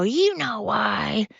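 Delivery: smug menace. Pretend. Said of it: "I'll go".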